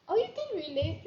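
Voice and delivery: high, loud pleased exclamation; light up at the comment; surprised delight. Real, not acted. Host reacting to a viewer's comment, delighted surprise.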